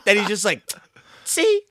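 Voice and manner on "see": very high, loud see; hammed-up gloating kid; imitating a gloating kid; mock taunting kid.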